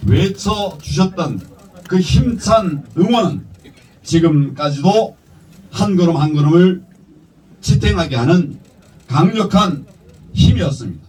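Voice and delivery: rousing political speech; formal speech. Real, not acted. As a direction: deliver it like a campaign address. Prepared speech read at rally.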